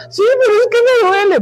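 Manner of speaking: imitated crying daughter complaining; whining hurt child. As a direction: whimper it like a small girl in pain. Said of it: "estaba llore y llore".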